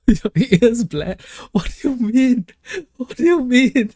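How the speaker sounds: mock offended. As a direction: play it hurt, squeaky and over the top.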